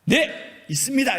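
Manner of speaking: confident loud answer. Shown as a demonstration. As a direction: answer boldly like a preacher. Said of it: "이렇게 얘기해야죠". (높고 크게 자신 있게 대답)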